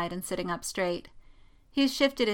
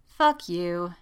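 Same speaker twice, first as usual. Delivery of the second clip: ordinary delivery, nothing marked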